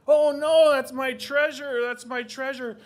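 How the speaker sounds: loud, high cry of alarm; panicked grandfather. Acted. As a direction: wail in panic over stolen treasure. Grandfather panics over lost treasure.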